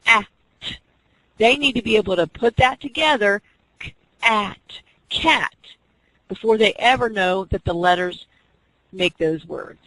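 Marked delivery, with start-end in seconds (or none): 3.8-5.5 "k, at" separated, then "cat"; blending demo. Shown as a demonstration. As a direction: join the sounds into the word. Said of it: "when we say k, at".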